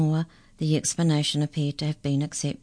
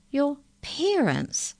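slow, high-pitched disbelief; astonished; 11 semitones higher, much slower than usual. A narrator's reading. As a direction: draw the question out high in astonishment. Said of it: "asked the incisor in astonishment".

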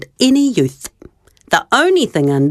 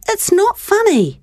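higher, hurt and cross; a touch of anger; 12 semitones higher than usual. Retold or imitated. Child cross at laughing brother, mildly angry. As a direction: protest that it's no joke. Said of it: "It made me mad".

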